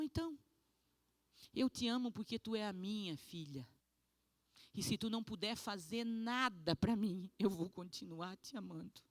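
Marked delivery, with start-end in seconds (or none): none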